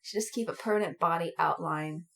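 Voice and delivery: mock territorial. Cohost claiming her parking spot, low mock growl. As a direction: growl like a beast guarding turf.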